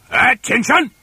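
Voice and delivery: high, loud drill command; fearsome trainer's bark. Acted. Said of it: "barked in his fearsome monkey trainer's voice".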